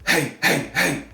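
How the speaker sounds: raspy diaphragm-pushed hey; harsh vocal demo. Shown as a demonstration. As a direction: push from the stomach, rasping. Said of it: "just go like".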